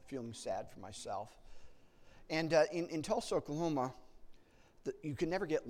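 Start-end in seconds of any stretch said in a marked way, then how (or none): none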